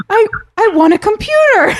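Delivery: high excited voice, imitating someone else's impression; imitating Brandon. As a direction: squeak it out like an overeager contestant. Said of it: "your portrayal of him cracked me up".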